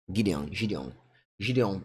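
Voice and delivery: Gideon with a soft French zh; French accent. Shown as a demonstration. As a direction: say Gideon the French way, stressed at the end. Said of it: "say it in French accent".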